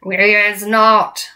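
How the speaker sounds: loud, slow giant chorus cry; drawn-out brutish shout. A narrator's reading. Other giants backing their leader, shouted. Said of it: "shouted the other giants".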